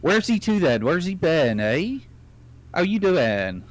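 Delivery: high, loud West Country farmer; West Country accent. Retold or imitated. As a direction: imitate a wife's broad West Country greeting. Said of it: "she sometimes will speak".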